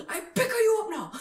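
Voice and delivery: put-on high, loud, samurai-like comic accent; botched Scottish accent. Retelling the actor helping her up, botched Scottish accent. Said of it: "The samurai warrior?".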